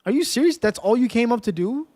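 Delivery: real outrage; outraged disbelief. Real, not acted. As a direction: call out the attacker in disbelief. Mob attacks again.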